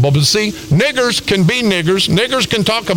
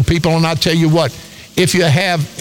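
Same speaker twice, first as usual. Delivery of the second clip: normal talking voice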